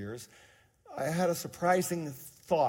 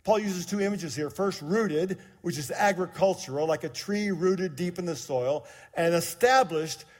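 louder, raised scripture preaching; 5 semitones higher, 7 dB louder than usual. Acted. Pastor reading Ephesians to the church, forceful. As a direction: proclaim the verse to the church.

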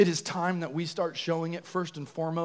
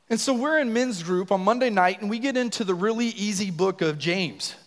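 normal talking voice

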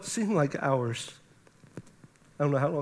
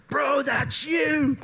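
high, drawn-out cheerleading shout; imitated brother's excited holler; over-excited; 8 semitones higher, much slower than usual. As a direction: yell it like his biggest fan. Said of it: "calm down".

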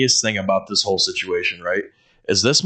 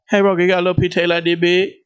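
fast, higher, jumbled mock request; garbled version; 8 semitones higher, 4 dB louder, faster than usual. Imitating the coworker's garbled request for tape. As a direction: blurt it out all mixed up. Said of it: "But instead it came out".

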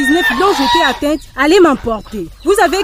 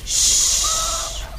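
soft unvoiced shush; whispered hush; 8 dB quieter than usual. Hushing the granddaughter.

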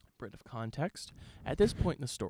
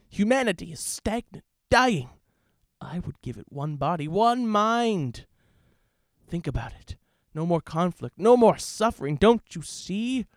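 loud, higher, grandiose hammy villain monologue; game villain; 4 semitones higher, 8 dB louder than usual. Acted. Villain explains his plan to the hero, loud grandiose ham. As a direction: ham up a megalomaniac speech. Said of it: "I will be Alex Mercer".

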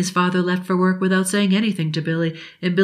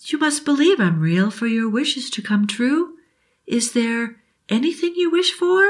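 put-on kind old genie; 6 semitones higher than usual. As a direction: promise it like a fairy godmother. Genie speaks.

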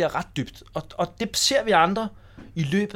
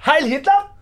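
loud mock salute shout; 9 semitones higher, 8 dB louder, slower than usual. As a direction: bellow it like a rowdy schoolboy. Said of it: "så blev der bare råbt".

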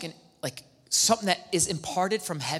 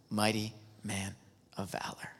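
softly whispered, unvoiced and low; 8 semitones lower, 11 dB quieter than usual. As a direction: breathe the title like a secret blessing. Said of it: "I think he talks like that".

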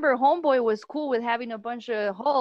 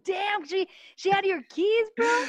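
high-pitched, incredulous reaction; can't believe what a friend just said; astonished disbelief; 10 semitones higher, slower than usual. Real, not acted. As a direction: blurt it out in amazed disbelief. Friend reacts to news of keys.

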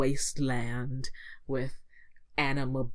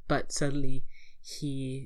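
normal talking voice

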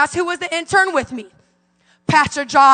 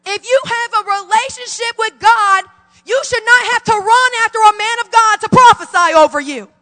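preaching; 5 semitones higher, 6 dB louder than usual. Acted. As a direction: preach it with full force to the church. Preaching at full force.

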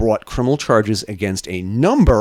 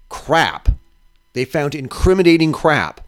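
usual speaking voice

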